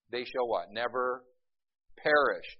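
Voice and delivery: ordinary talk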